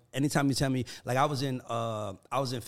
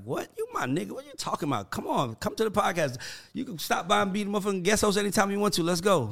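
higher, louder hyped invitation; excited; 9 semitones higher, 4 dB louder, faster than usual. Retold or imitated. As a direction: hype her up to come on. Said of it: "I was like".

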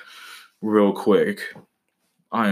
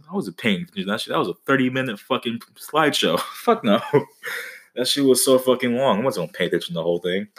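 ordinary delivery

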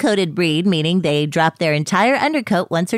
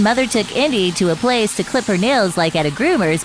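normal talking voice

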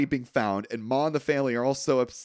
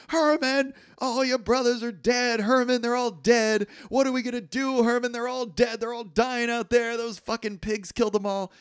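hammed-up grieving Ma, higher; mock ranting mother; 8 semitones higher than usual. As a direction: wail it at the son like a grieving mother. Ma ranting over dead sons.

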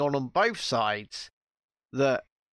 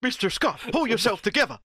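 mock captain; 7 semitones higher, faster than usual. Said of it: "captain scolds engineer".

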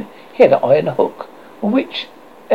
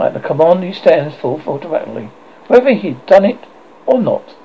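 plain reading voice

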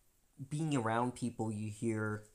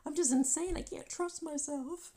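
much higher, put-on panicky voice; mock self-doubt. Doubting herself after Chris's remark, high. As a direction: fret that you're losing it. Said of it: "I was just like".

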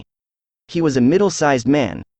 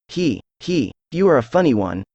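ordinary delivery

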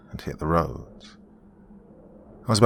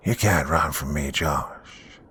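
altered, slightly lowered monster voice; homunculus speaking; 3 semitones lower, slower than usual. A narrator's reading. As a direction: menace your cornered prey. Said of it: "before finally speaking".

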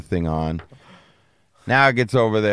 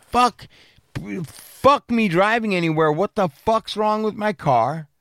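retold furious outburst; 10 semitones higher than usual. Yelling at the mechanic over the broken car, a retold angry outburst. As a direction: act out blowing up at him, pitched way up.

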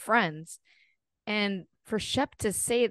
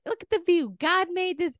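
mock pious Southern mom; 10 semitones higher than usual.

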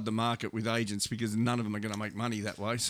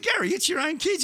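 incredulous plea; 4 dB louder than usual. Retold or imitated. Agent pleads with stubborn father.

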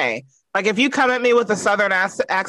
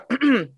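mock preparatory throat clear; staged little ahem; 4 semitones higher than usual. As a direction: clear the throat before speaking up. Acting out a meeting speaker.

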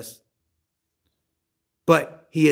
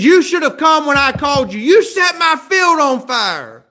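loud angry yell, higher pitch; yelling match; 8 semitones higher, 5 dB louder than usual. Acted. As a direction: shout it back at him in fury. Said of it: "there was a yelling match going on".

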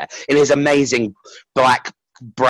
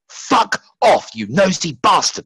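inner angry voice; 6 semitones higher, slower than usual. Retold or imitated. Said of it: "this voice in me was like".

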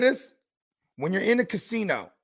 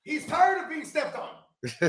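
mock high voice; 9 semitones higher, slower than usual. Joking about injured player.

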